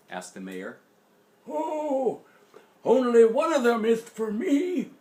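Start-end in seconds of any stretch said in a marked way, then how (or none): 1.5-4.9 put-on high, loud old man voice; Grandpa. A narrator's reading. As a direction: pop up high like a cheerful grandpa. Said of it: "replied Grandpa".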